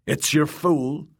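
high, slow jester's call; drawn-out, high fool's announcement. A narrator's reading. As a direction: taunt her in a high fool's voice. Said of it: "he called to Brian".

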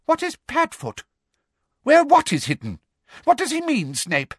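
high-pitched eager questioning; eager demanding. A narrator's reading. Umbridge questions Snape about Padfoot, eagerly demanding. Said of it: "looking eagerly from Harry to Snape".

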